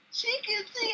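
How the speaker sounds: high, thrilled exclamation; excited. Retold or imitated. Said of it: "I was jumping up and down like".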